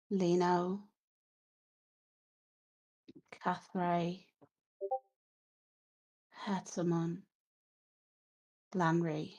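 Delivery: faint corpse voice, fading through the names; name them one at a time, softly, with long gaps; hushed, halting voice of the dead; dead woman answering. Acted. Dead Miletta names her family.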